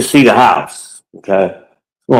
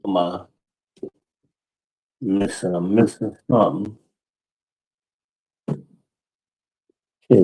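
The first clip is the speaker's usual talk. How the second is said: muttering to himself; 2 semitones lower, 9 dB quieter, slower than usual. Real, not acted. Searching room, muttering.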